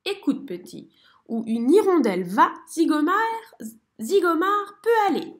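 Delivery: fanfaron, de plus en plus fort et haut; merle vantard. A narrator's reading. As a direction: se vanter avec aplomb. Merle boasting. (merle fanfaron qui se vante)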